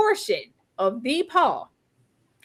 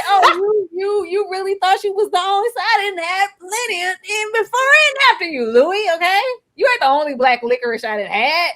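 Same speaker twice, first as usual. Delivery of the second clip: sassy taunt; 6 semitones higher than usual. Retold or imitated. Lestat taunting Louis about other lovers, sassy and high. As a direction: throw the shade at Louis.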